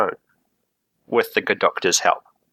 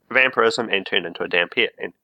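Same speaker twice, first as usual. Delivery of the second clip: normal talking voice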